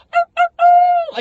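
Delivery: high, loud, drawn-out mock howl; werewolf howl. Someone making noises at the haunted farm, werewolf howl. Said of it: "like a werewolf noise".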